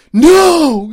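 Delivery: high, very loud mock protest; drawn-out retold cry of dismay; horrified shout. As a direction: yell it like a kid refusing in dread. Boys horrified at going back.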